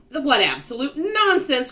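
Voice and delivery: loud, high scoffing outburst; indignant cry. A narrator's reading. Earthworm rejects the grasshopper's optimism, indignant cry. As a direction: explode in disbelief. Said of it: "cried the earthworm".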